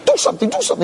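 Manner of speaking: high-pitched, urgent mock plea; panicked client. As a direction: imitate a desperate client begging his lawyer to act. Anxious client urging his lawyer.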